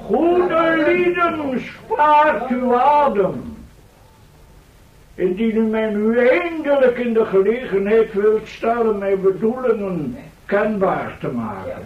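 calm the crowd from the podium; formal speech. Acted. Professor continues his address. (plechtige toespraak, soms vertraagd)

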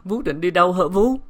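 anxious, imploring question; distraught stammering. A narrator's reading. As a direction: call after her nanny in dismay. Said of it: "nàng bước theo lắp bắp".

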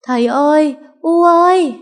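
high, loud terrified call for her parents; shrill calling. Acted. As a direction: cry out for help into the dark. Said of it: "cất tiếng the thé gọi". (gọi the thé, cao và to)

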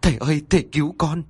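quick, high desperate pleading; wife on her knees begging the master. Acted. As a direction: kneel and beg for rescue. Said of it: "quỳ xuống để van xin".

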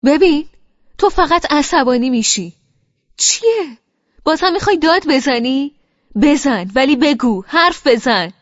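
he loses patience and yells at her; man yells at her to finally speak; angry shout. Acted. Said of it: "عصبی گفت".

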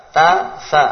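ta, sa drilled loud and clear; reading letters aloud. Shown as a demonstration. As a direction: say "ta, sa" crisply as letter sounds. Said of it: "Coba dibaca". (membaca "ta, sa" dengan jelas)

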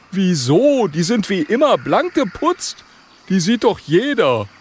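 high, punchy character voice; high-pitched miffed dragon; indignant little dragon. Acted. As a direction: complain as a small, proud dragon.

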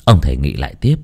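ordinary delivery

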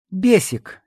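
high-pitched, coquettish call; playful. A narrator's reading. Woman luring her admirer over, playful. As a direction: call him flirtatiously by a pet name. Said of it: "игриво заговорила стерва".

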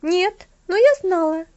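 put-on high, loud defiant girl; stubborn little girl. A narrator's reading. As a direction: answer back stubbornly. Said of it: "упрямо ответила Алида".

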